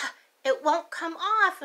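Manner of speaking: mock frustrated child voice. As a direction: kid stuck and annoyed. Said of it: "she's like".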